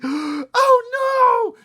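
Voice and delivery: very high, loud shriek; horrified scream. A narrator's reading. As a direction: scream in full alarm. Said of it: "Sheila screamed".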